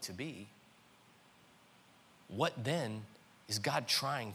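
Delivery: soft, low, hushed sermon; quiet reflective preaching. Real, not acted. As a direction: draw them in with a soft, low voice.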